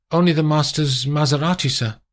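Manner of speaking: mild servant voice; a hint of the chauffeur. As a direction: hand the clothes over. Chauffeur answering.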